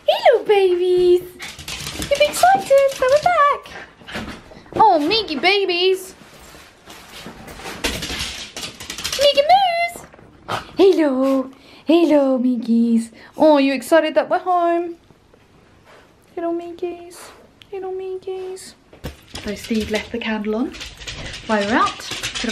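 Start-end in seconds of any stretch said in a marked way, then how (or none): 0.1-10.0 dog voice. Real, not acted. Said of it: "My dog voice is so annoying". 10.8-15.0 cooing at pets, a bit higher; dog voice. Real, not acted. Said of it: "My dog voice is so annoying". 16.3-18.7 soft, higher cooing at the dog. Real, not acted. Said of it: "My dog voice is so annoying".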